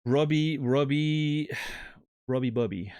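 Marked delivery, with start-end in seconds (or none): none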